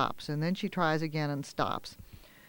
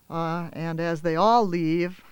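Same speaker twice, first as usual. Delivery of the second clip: ordinary delivery, nothing marked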